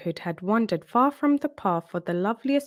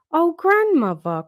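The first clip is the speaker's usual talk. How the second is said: a startled cry, much higher and louder; 9 semitones higher, 7 dB louder than usual. A narrator's reading. Girl cries out at the strange-looking grandmother, startled. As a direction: gasp it out at grandmother's strange face.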